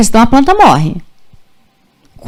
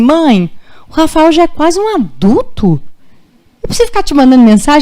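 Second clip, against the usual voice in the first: mother mimics her small daughter's higher protest; imitating young daughter; 5 semitones higher than usual. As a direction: play the little sister protesting, a bit whiny. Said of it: "A minha filha pequena fala assim".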